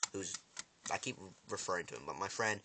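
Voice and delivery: ordinary talk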